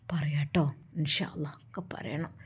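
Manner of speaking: low, whispered soothing; hushed reassurance. A narrator's reading. Uncle whispering a promise to the crying girl. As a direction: hush the child gently.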